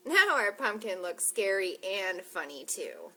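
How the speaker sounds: bright storybook read; reading to children. Acted. Librarian reading the story aloud to kids, bright. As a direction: read each line to the kids.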